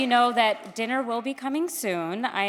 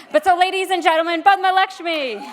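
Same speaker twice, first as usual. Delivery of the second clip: big announcer intro; 9 semitones higher, 9 dB louder than usual. Real, not acted. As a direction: announce her name to the room. Introducing honoree to crowd.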